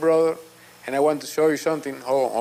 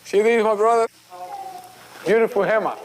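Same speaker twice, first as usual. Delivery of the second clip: normal talking voice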